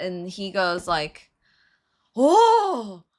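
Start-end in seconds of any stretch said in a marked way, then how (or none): 2.2-3.0 loud, high mock "Oh!"; hammed-up awe, high and loud; impressed exclamation. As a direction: act out a fortune teller's amazement. Palm reader reacting to C T's hand.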